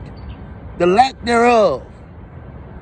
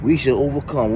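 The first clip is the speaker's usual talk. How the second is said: low, flat, dismissive "We shall overcome"; dismissive quote; 6 semitones lower than usual. Retold or imitated. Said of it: "singing that same bullshit".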